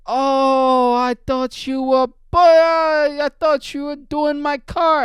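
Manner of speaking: high, loud, drawn-out Jamaican mimicry; imitated Jamaican accent. Jamaican dude confronts man working near his car, loud Jamaican holler. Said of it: "I don't even know what the accent he has".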